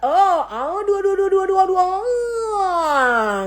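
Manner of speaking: high, drawn-out whimpering with a cry, aduh stretched slowly; mock whimpering. Hurt partner compared to an ex, hammed-up crying whimper. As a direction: play the hurt partner moaning, a hammed-up sob. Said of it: "kamu ngerasa".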